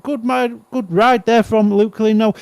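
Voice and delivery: high-pitched, excited play-by-play; live race commentary. Real, not acted. Speedway commentator following riders on the inside, excited live commentary. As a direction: call the race as it unfolds.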